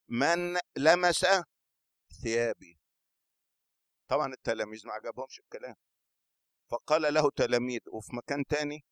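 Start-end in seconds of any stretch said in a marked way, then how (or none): 0.1-2.8 slow, drawn-out question to the crowd; slow searching question. A narrator's reading. Jesus asking the crowd slowly who touched him. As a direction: stretch each word, searching.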